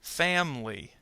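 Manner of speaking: "Family." said as FAM-lee; "Family." in two syllables, without the i; dropped middle syllable. Shown as a demonstration. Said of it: "the I in family disappears".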